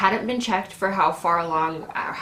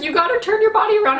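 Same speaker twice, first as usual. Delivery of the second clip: high, loud, fast mock instruction; urgent nurses; 7 dB louder, much faster than usual. Nurses direct woman into tub, high rushed shouting. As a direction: imitate nurses calling out orders quickly.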